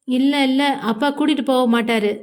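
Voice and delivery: ordinary delivery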